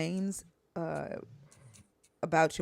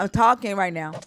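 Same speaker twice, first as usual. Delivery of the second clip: raised voice cutting off an interruption; loud, firm; 4 semitones higher, 12 dB louder than usual. Real, not acted. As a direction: firmly say you're talking now. Shutting down interruption.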